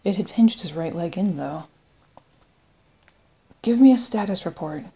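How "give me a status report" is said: raised, commanding call; louder, higher demand for news. A narrator's reading. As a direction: call it across to the crew. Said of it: "he called across the bridge".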